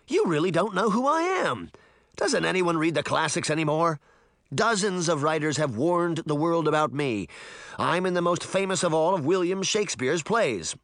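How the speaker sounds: put-on high, affronted boy voice; indignant rant. A narrator's reading. Boy unknown to the girls, indignantly boasting. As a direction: rant with wounded vanity, pitched high.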